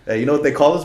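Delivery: ordinary talk, nothing marked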